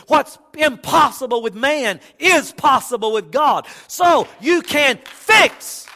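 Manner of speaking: loud, ringing shout, slowing on you can fix; loud preacher's cry of promise; preaching shout. Real, not acted. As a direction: lift the room with it.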